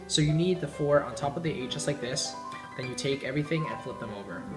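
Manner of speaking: usual speaking voice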